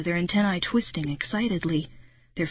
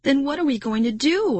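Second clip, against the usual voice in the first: higher-pitched alarmed question; crying out; 6 semitones higher than usual. A narrator's reading. Narrator to friend while trapped, crying out. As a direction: let the voice climb toward the end. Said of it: "I cried".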